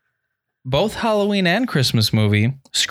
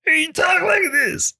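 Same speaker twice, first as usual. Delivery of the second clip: much higher, put-on cartoon sidekick voice; cartoon rabbit voice. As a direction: squeak it out like an imaginary rabbit friend. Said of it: "who did that voice of the rabbit".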